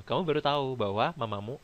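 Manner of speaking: ordinary talk, nothing marked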